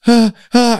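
high, startled yelps of mock panic. Friend imitating Ginge panicking at the snot on his neck. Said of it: "he panics".

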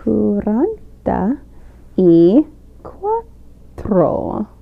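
"cuarenta ... y ... cuatro", one word at a time; slow and separated. Shown as a demonstration. Guest repeats the Spanish number for hosts, slow and careful. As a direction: draw out "cuarenta y cuatro" very slowly with long pauses. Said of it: "We, like, say it again".

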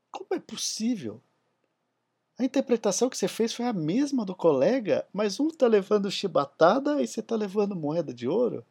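high, loud disbelief; astonished. Acted. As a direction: voice jumps up in shock. Courtier asks in disbelief.

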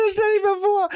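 high, squeaky words through laughter; laughing. Real, not acted. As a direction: squeeze it out while cracking up. Speaking while cracking up.

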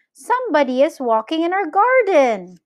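put-on high-pitched boyish announcement; excited calling out. A narrator's reading. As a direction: report the sighting in a high, excited voice. Boy calls out to family.

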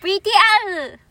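high cute anime call; high cute anime girl. Pretend. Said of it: "アニメ声".

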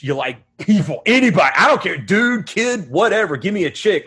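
ordinary talk, nothing marked

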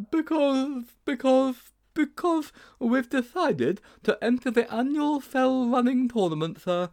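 put-on very high, slow, stalling boy; high-pitched nervous schoolboy; panicky improvising. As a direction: grope for an excuse, repeating yourself up high. Sponge improvises excuse to teacher.

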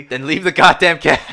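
loud, high acted outburst; exasperated shout. Survivor ordering the lady to drop the cat, exasperated. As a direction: shout it, out of patience.

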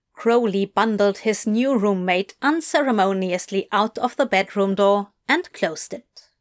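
ordinary delivery, nothing marked

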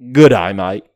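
Good day, mate punched loud; loud mock Aussie farewell. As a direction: bellow it like a stage Australian.

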